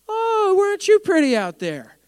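high, slow, sarcastic Irish lilt; mock him in a little Irish accent; sneering Irish-accented taunt; mock Irish sarcasm. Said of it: "that was a little Irish".

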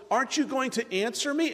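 high, impatient calling out; exasperated calling. Acted. David calling to Abner across the distance, exasperated. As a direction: call out as if you've been at it for hours. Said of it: "As if he's been calling for hours".

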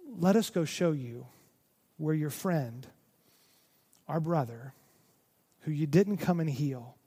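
halting, heavy grief, fading low and soft; thick with emotion. Retold or imitated. Grieving sisters leading Jesus to the tomb, heavy and halting. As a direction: let long pauses weigh down each phrase. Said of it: "The emotion is thick".

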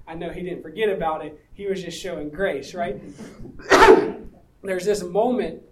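normal talking voice

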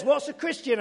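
high, loud mock wife asking; puzzled wife. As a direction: play the baffled wife. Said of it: "She says".

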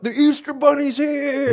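high-pitched drawn-out call; excited announcement. Acted. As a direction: announce the arrival in a long, high holler. Acted gleeful arrival announcement.

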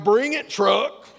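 loud, drawn-out cocky dare; puffed-up turtle daring a truck; bowed-up turtle challenge. Retold or imitated. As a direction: call out a mock showdown challenge. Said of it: "he was, like, bowed up".

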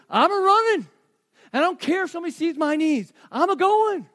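high, loud joyful cry of a father; a father too happy to care who looks; elated father. Acted. Father voiced running to son.

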